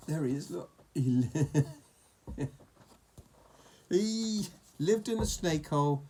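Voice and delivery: usual speaking voice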